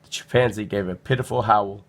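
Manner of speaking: ordinary delivery, nothing marked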